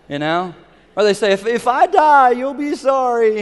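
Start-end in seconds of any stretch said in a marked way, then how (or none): 1.3-3.4 high, loud mock wail; hammed-up childish threat to guilt a parent; melodramatic child. Child guilt-tripping parent over a spanking, hammed-up wail.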